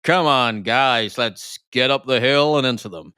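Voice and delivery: gruff war-movie officer call; rally the men up the hill; Robert Mitchum rallying troops. Retold or imitated. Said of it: "Robert Mitchum coming through going".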